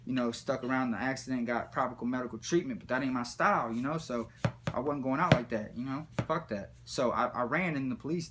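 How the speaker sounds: normal talking voice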